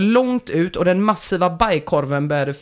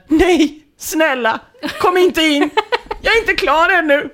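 high, louder mock whimper; hammed-up desperate begging, pitched way up; whimpering plea; 10 semitones higher, 4 dB louder than usual. Begging the girlfriend not to come in, acted whimper. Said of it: "Jag kvider".

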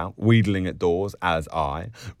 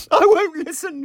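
loud, high squealed refusal; girlish squeal, pitched way up; crying out; 6 dB louder than usual. A narrator's reading. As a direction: shut them out with a high cry. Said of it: "she cried".

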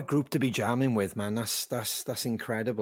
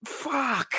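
mock stunned swear; 13 semitones higher than usual. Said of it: "I was like".

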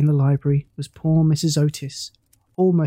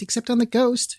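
a higher, gleefuller shriek; mild childish shriek, pitched higher; 8 semitones higher than usual. A narrator's reading. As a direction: push the repeat up even higher. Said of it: "shrieked the twins".

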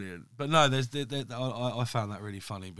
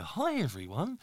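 bright, high mock presenter voice; a light overdone hello to camera; put-on presenter greeting; 7 semitones higher than usual. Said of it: "the way it might slip out, I go".

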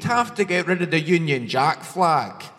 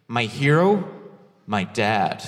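low, earnest put-on American accent; 7 semitones lower, slower than usual. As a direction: play a sincere American, voice dropping low. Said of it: "an American will be like".

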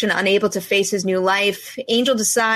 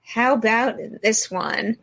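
ordinary talk

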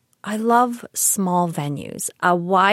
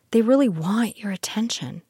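whispering; 4 semitones higher than usual. Real, not acted. Whispered aside.

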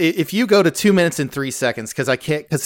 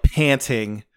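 usual speaking voice

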